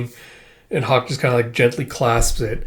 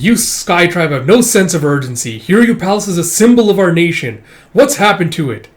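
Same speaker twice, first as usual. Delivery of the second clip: raised, louder mock outrage; acted-out indignation, building higher and louder; retold indignant rebuke; 5 semitones higher, 6 dB louder than usual. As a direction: berate them for lacking urgency. Retold indignant rebuke about palace.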